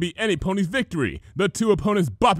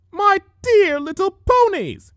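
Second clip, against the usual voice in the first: high, loud, slow grand address; declaiming; 8 dB louder, slower than usual. Acted. Villainess addressing the crowd of ponies, grandly declaiming. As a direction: declaim to the whole town square. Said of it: "she declaimed".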